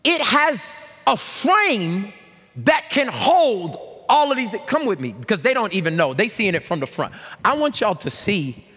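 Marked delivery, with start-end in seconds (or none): none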